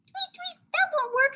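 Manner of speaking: very high chirpy bird; squeaky storybook bird; bird character. A narrator's reading. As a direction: tweet it in a tiny falsetto.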